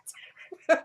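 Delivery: high, loud burst of laughter. Real, not acted. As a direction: burst out laughing at your own remark. Laughs at own remark.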